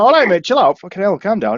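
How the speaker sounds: retold exasperated plea. Driver to irate bloke, exasperated protest. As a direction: tell him to back off, retold. Retelling telling angry bloke to calm down.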